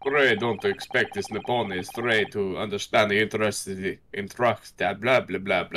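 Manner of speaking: put-on a touch of an upper-class British accent; lightly voiced British prosecutor, in a British accent; posh British accent. Prosecutor sneers at the defense, mild British accent. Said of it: "hard for me to say that in his accent".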